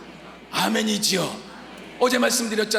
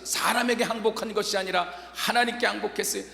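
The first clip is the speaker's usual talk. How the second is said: ordinary delivery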